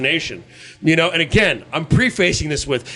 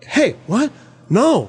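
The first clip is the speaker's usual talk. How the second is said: higher, confused Boston voice; a quick confused Boston Wahlberg; Wahlberg impression; 4 semitones higher, much slower than usual. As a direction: play it baffled Boston like Wahlberg. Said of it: "anybody can go".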